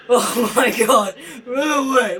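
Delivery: loud slow panicked yell; panicked shout. Real, not acted. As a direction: shout in real panic as you flee. Fleeing monster in game.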